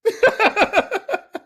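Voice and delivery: high, loud laughing burst; high burst of laughter. Real, not acted. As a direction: let a big laugh break out.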